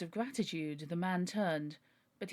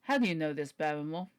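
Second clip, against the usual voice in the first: put-on lower-pitched question in Sir Henry's proper accent; corrected accent for Sir Henry; 3 semitones lower, 4 dB louder than usual. Sir Henry questions butler Barrymore, in his corrected accent. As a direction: retake it lower, back in the character's accent. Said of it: "wrong accent".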